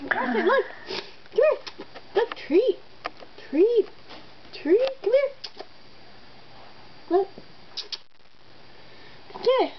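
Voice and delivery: high sing-song call to a cat; calling a pet. Real, not acted. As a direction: lure the cat with a treat, high. Luring cat with treat.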